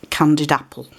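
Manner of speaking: normal talking voice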